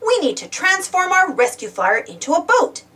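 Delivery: ordinary talk, nothing marked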